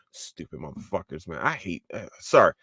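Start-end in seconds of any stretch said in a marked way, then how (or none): 0.1-1.4 much softer, under the breath; muttered insult. Real, not acted. Vlogger muttering about anti-woke viewers, soft contempt.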